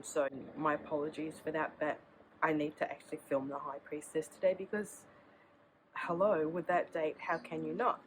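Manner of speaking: ordinary talk, nothing marked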